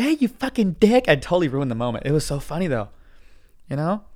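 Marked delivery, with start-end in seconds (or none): 0.0-1.1 higher-pitched mock indignant mom; imitated offended mom. Teased crying mom to her son, mock indignant. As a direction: put on mom's high, tearful protest.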